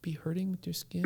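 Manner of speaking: soft whispering father; father whispering comfort to his son; tender whisper. Retold or imitated. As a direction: whisper love to calm him. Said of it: "It's like".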